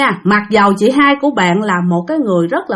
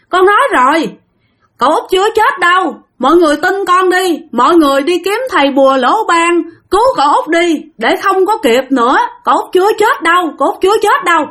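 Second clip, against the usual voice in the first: possessed outburst; 7 semitones higher than usual. A narrator's reading. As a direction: burst out insisting he is not dead. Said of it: "bộc phát nói thêm lần nữa".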